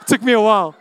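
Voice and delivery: high laugh breaking into words. Real, not acted. Preacher laughing at the word wheelbarrow, high and loud. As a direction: laugh it out, amused at yourself.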